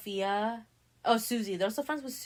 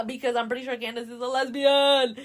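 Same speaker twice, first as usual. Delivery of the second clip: loud, higher, through laughter; real laughing; 4 semitones higher, 11 dB louder than usual. Real, not acted. Storyteller sharing a theory about Candace, laughing loudly. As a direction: burst out laughing into the words.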